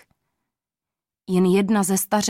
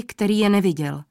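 usual speaking voice

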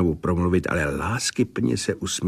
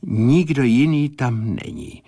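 lightly voiced seer in a raised pitch; a hint of an entranced clairvoyant, higher; clairvoyant's vision; 6 semitones higher than usual. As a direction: pick out each detail as it appears to you. Clairvoyant describing the children.

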